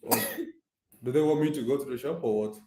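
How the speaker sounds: ordinary delivery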